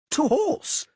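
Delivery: high, loud ringing call; rallying cry. A narrator's reading. Bard crying out to his companions, loud and high. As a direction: rouse the whole company to ride. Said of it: "cried Dandelion".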